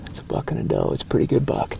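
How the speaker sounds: excited hushed whisper; wildlife watching whisper. Real, not acted. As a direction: whisper it, eager but hidden. Hunters hiding from nearby deer.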